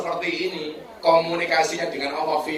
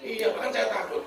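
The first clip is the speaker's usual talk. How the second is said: high, soft meek excuse; mock-fearful wife; timid wife; 5 semitones higher, 7 dB quieter than usual. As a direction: answer meekly, voice up and small. Wife excuses herself meekly.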